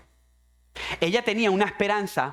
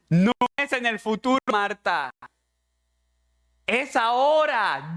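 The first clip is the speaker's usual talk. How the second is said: Jesus to Martha; 5 semitones higher than usual. Acted.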